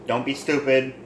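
ordinary talk

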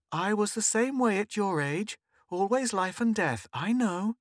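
high-pitched, knowing mum; indulgent mother. A narrator's reading. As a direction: humour the child in a high motherly voice.

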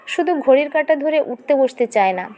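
ordinary delivery

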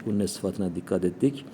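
normal talking voice

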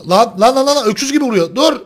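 loud panicked yelling; alarmed shouting. Real, not acted. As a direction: yell in alarm at getting hit. Getting hit hard in game. (panik içinde bağırarak)

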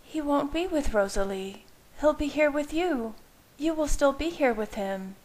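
urgent insistence; Bella, insisting. Acted.

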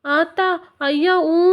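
put-on slow, high child voice; a small boy's let-down wail; disappointed little boy; child's whine. A narrator's reading.

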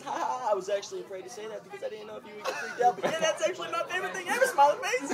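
high, giggly, laugh-shaken voice; reading while cracking up. Real, not acted.

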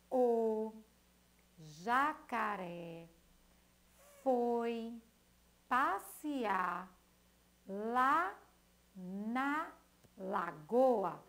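slow, each word set apart with pauses. Shown as a demonstration. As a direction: read the line word by word for the class. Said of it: "com diferentes composições silábicas". (palavra por palavra, pausado)

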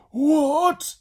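high, loud outburst of disbelief; shocked exclamation. Acted. Twins hear Ned's plan, shocked exclamation. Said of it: "exclaimed the Envies".

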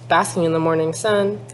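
ordinary delivery, nothing marked

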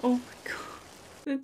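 slow, whispered heartbreak; choked whisper. Real, not acted. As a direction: breathe it out, overwhelmed. Sad scene of the dog.

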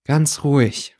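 usual speaking voice

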